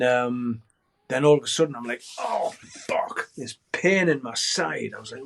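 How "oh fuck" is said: pained whisper. Retold or imitated. Sudden pain in his side.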